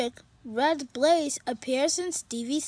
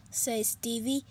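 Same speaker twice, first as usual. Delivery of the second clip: ordinary delivery, nothing marked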